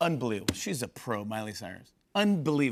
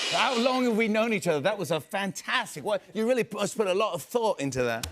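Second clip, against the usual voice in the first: higher-pitched, sarcastic gushing; mock praise; 6 semitones higher than usual. [name] teasing host about the intro, mock praise. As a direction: gush over it with teasing sarcasm.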